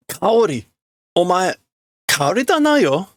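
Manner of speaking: man doubting it's Kaori, high; character voice. Acted. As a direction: ask her identity in fear. Voicing character.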